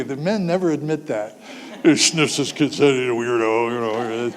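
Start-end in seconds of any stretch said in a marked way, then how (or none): 1.8-4.3 mock skeptical men. Men's skeptical voice.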